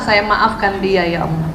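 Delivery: demonstrating a quiet, forgiving prayer to God; soft prayer. Shown as a demonstration. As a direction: pray it gently, as if forgiving. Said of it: "Maka bilang".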